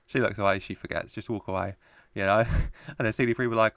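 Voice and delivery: usual speaking voice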